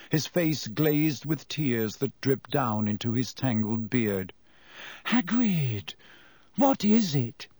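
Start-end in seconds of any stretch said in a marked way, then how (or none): 5.1-7.5 aghast. A narrator's reading.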